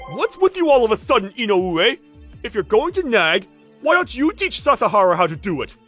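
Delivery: loud, high-pitched annoyed comeback; irritated retort. Acted. Senior snapping at a nagging colleague, irritated. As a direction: throw the blame back at them. Senior snaps back in quarrel.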